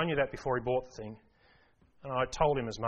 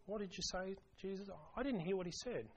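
higher, much softer mock voice; mock-innocent aside, higher and hushed; mock deaf excuse; 4 semitones higher, 15 dB quieter than usual. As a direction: play the churchgoer pretending not to hear. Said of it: "people who pretend they didn't hear that".

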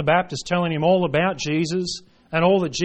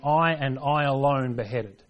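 slow, deliberate stress on both words "I"; emphatic I. Acted. Preacher voicing Herod's admission to the beheading, emphatic "I". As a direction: lean hard on "I" in "I and I alone". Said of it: "is emphatic".

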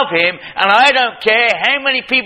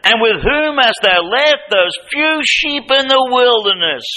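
higher, slowed scornful big-brother voice; Eliab's scornful rebuke; 7 semitones higher than usual. Retold or imitated. Preacher voicing Eliab scolding David, higher scornful rebuke. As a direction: sneer it down at little brother. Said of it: "He says".

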